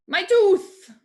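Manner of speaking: high, loud comic yelp; sudden loud joke about a tooth. Acted. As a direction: blurt it out as the punchline. Joke answer, sudden outburst.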